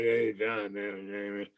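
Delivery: mock a doom-laden voicemail; grim. Actor shows how a bad-news call would sound, grim. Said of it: "he didn't sound like".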